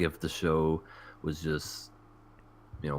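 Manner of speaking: ordinary talk, nothing marked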